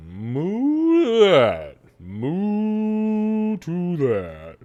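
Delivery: long, high, drawn-out moos; slow lowing cow toast; mooing cow. Acted. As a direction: stretch each moo in hearty agreement. Cow character agrees with a moo.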